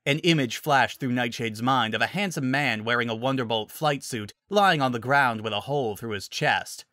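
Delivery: ordinary delivery, nothing marked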